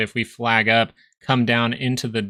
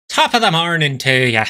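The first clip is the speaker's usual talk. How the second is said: loud, higher hammed-up Irish greeting; Irish accent; 4 semitones higher, 6 dB louder than usual. Streamer shows his Irish accent to chat, loud stage Irish. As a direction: belt out a stage Irish hello. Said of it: "I put too much into the te ya".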